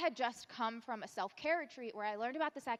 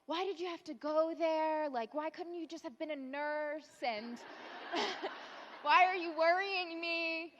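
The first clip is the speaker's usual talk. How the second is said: high, wailing mom complaint; guilt-trip your child in a raised, plaintive voice; 5 semitones higher than usual. Retold or imitated.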